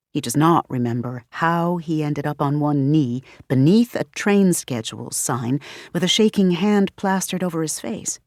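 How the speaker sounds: usual speaking voice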